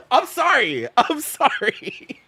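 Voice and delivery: high, laugh-choked apology. Real, not acted. Streamer apologising to chat mid-giggle, laughing helplessly. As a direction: apologise while cracking up, dragging the second one.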